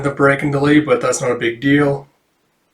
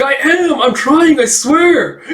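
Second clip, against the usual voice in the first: very high, louder, straining to insist; strained pleading; 12 semitones higher, 5 dB louder, slower than usual. Acted. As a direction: plead while shaking in the pose. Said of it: "It's like".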